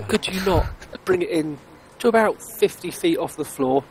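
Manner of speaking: usual speaking voice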